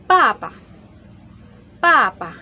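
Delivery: "papa" falling sharply on each syllable; fourth tone. Shown as a demonstration. Teacher giving fourth-tone example, sharp falling "papa". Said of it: "Sounds like".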